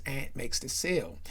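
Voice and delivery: ordinary delivery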